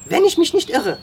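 high, loud, excited marvelling; amazed admiration. A narrator's reading. Sam praising the boy's silent capture, amazed. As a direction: exclaim with escalating wonder.

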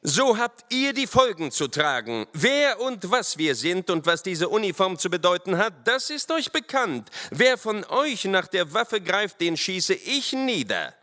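high, forceful officer's threat; stern lieutenant. A narrator's reading. Lieutenant threatens to shoot resisters, stern.

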